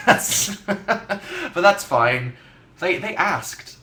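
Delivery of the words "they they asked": usual speaking voice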